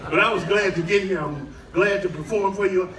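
ordinary delivery

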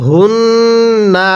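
হুন্না with a nasal ghunnah on ন্ন and a short final vowel; ghunnah, no stretch. Shown as a demonstration. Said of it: "গুন্নাহ করব তবে টান দিব না".

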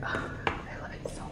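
hushed, whispered delight; awed whisper. Real, not acted. Overcome by the gifts.

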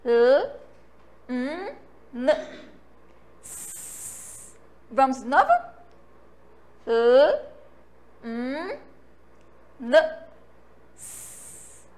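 each sound held apart, L M N then a hissed S; lll, mmm, nnn, sss one by one; letter sounds. Shown as a demonstration. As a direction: model the four sounds slowly for children. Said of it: "Vamos lá".

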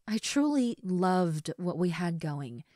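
normal talking voice

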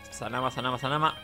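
ordinary delivery

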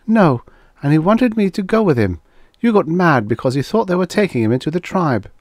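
high-pitched character voice; Karen's insistent recounting. A narrator's reading. Woman telling Guthrie why he got mad, insistent. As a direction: keep it well above narration, insistent.

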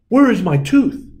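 high, loud urgent question; anxious search. A narrator's reading. Tooth Fairy searching the closet, urgent. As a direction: call out hunting for it.

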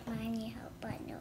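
whispering. Real, not acted. Quiet aside while peeling.

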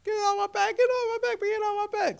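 very high-pitched mock scream without extra volume; retold screaming. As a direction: imitate a frightened child's repeated cries. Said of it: "running through the house screaming".

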